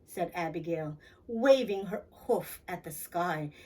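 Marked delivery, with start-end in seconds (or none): none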